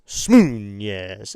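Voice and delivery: "Smoonez" with a loud, stretched "Smoo"; "Smoonez" boomed low and drawn out; playful name pronunciation. Shown as a demonstration. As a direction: relish "Smoonez", leaning long on the oo. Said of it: "I like saying his name".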